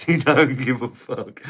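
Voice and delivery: reading it out while laughing; laughing through it. Real, not acted. Laughing as he reads out a viewer's comment. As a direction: laughs break through the punchline.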